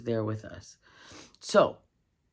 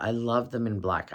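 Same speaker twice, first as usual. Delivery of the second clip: normal talking voice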